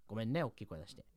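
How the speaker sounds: low, soft, sorry voice; sudden gentle apology. Acted. Comic soothes partner right after yelling, gentle. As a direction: drop straight into a soft apology. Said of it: "怒って優しくしては".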